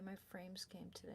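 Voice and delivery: soft unvoiced whisper. Real, not acted. Repeating her frames news to the camera, whispered. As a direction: breathe it out in a whisper.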